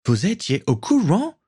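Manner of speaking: high-pitched incredulous question; choked with shock. A narrator's reading. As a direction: ask in disbelief, pitch leaping up. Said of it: "s'étrangla Dennis".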